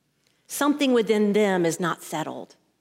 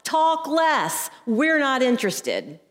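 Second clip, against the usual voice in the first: high-pitched mock heckle; retold yell; 5 semitones higher, slower than usual. Student heckling the yoga teacher, mock yell. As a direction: call out sharply, imitating a fed-up student. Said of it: "He yells out".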